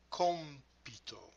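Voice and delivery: "compito" slow, stressed COM-pi-to; careful model of "compito", first syllable stressed; stress on third-last syllable. Shown as a demonstration. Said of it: "stress goes on the third to the last".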